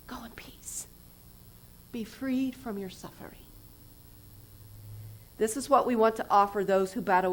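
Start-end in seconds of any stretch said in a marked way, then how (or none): 0.1-3.5 soft, hushed and tender; whispered blessing. Acted. Jesus sending the woman away in peace, whispered. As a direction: bless her gently in a whisper.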